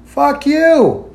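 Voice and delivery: put-on loud drunk yell; a stranger yelling across the street; retold shout. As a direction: shout it out of nowhere. Stranger yells at narrator.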